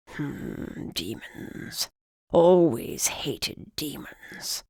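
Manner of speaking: put-on slow whispery old man. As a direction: rasp it out like a cranky old man. Old griffin sneers, slow whisper.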